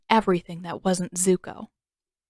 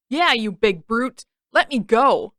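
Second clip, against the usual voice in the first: defiant yell; 3 semitones higher, 5 dB louder than usual. A narrator's reading. Sokka fighting the beast.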